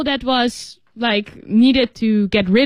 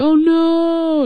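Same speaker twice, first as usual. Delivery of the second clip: long drawn-out moaning wail; mock-sorrowful drawn-out groan; moan of dismay; 7 semitones higher than usual. Shown as a demonstration. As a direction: stretch it into a pained, sad moan. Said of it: "low sound because of pain or sadness".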